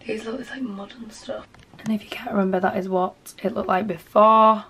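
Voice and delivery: ordinary talk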